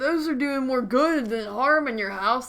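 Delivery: loud, high whiny mock voice; mocking bug defenders. Mocking people who defend house bugs, loud whiny voice. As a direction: sneer out the do-gooder's plea. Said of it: "It's like".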